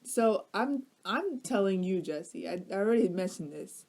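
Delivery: ordinary delivery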